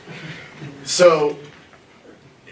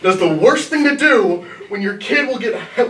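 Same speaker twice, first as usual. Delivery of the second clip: normal talking voice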